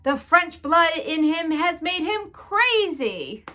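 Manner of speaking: blaming. A narrator's reading. Lester blames his wife, loud and slow. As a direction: lay the blame squarely on her. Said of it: "said Lester".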